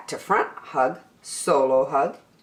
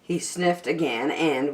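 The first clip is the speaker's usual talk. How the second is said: usual speaking voice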